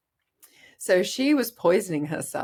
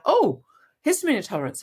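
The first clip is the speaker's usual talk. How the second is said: higher, louder and fast, an aha moment; sudden realisation; 8 semitones higher, 6 dB louder, faster than usual. Retold or imitated. Mother remembering the diagnosis, excited realisation. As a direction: blurt it out as it suddenly clicks.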